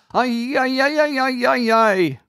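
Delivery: long high shriek; shrieking wail. A narrator's reading. Enchanter shrieking in rage at the children. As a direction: shriek to pierce the soul. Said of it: "screamed one heart-stopping shriek".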